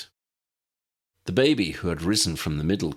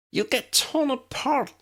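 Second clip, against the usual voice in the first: protesting. A narrator's reading. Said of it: "Tally protested".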